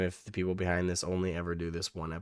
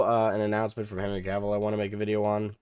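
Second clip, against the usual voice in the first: usual speaking voice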